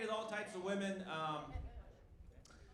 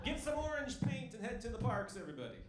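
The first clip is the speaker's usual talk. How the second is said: rallying call; 6 semitones higher than usual. Retold or imitated. Rallying cry in old story.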